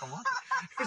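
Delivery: very high laughing "I want it"; laughing mock eagerness. Imagining himself at the Oscars, high and laughing. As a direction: joke about grabbing the Oscar. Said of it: "I'm like".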